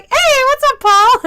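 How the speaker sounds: high, loud, delighted hello; excited greeting. Retold or imitated. Server greets her regular on the phone, excited and loud. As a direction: greet an old favorite with a big lift.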